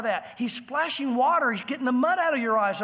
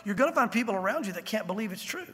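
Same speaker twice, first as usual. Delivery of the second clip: normal talking voice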